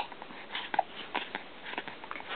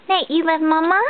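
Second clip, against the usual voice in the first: usual speaking voice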